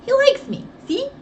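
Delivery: put-on confident boy. Little boy telling Nancy the bird likes him, proud. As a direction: crow it proudly. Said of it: "answered confidently".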